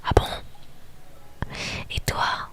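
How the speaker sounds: normal talking voice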